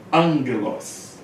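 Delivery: Angelos slow, with ng for the first gamma; teacher modeling Angelos. Shown as a demonstration. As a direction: say Angelos slowly as ang-gelos. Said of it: "Então fica angelos".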